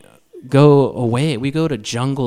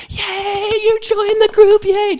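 very high, loud whooping cheer; cheering crowd; 6 dB louder, slower than usual. Acted. Sales room greeting new couples as they walk in, high cheering. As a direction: squeal a mock welcome over the party.

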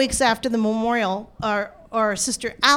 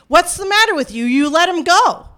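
loud, higher-pitched indignant accusation; raised voice, scolding the police; yelling; 5 semitones higher, 6 dB louder than usual. Retold or imitated. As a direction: act out yelling at the officers. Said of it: "yelling at the officers".

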